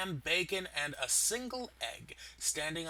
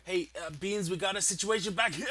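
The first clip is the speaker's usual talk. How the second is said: ordinary delivery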